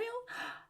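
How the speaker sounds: sharp high gasp of amazement; gasp of wonder. Acted. Storyteller gasping in wonder for the children. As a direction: gasp as if seeing the miracle.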